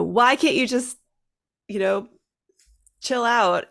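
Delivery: exasperated parent. Retold or imitated. Parent of a young child feeling helpless, exasperated plea. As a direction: voice a parent at wit's end. Retold parent losing patience.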